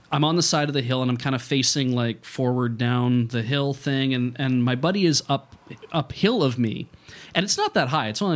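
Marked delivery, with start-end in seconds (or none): none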